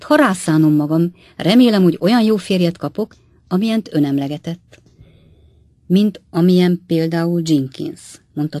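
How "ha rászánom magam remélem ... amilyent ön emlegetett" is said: plain reading voice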